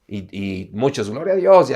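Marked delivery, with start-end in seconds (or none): 1.1-1.7 higher, louder mock praise; church exclamation. Believer using the church language, imitated praise. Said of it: "y tenemos el lenguaje".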